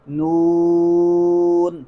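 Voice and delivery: "نون" held very long on a level note, n only at the end; slow, sustained "نون" kept out of the nose; correct noon. Shown as a demonstration. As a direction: stretch a pure oo in "نون" before closing. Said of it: "بلکہ ہم کیا پڑھیں گے".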